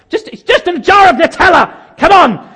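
high, loud comic outburst; exasperated shout. Acted. Dad at the self-service checkout, shouting in exasperation. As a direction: blow up as if you've had enough. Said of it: "I went nuts".

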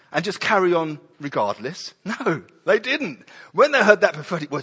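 usual speaking voice

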